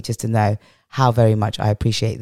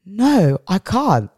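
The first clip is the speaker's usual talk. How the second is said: higher-pitched mock protest, No drawn up high; protesting refusal to children; 8 semitones higher, much slower than usual. Mum answering her children's plea for silence, higher protesting refusal. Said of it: "I was like".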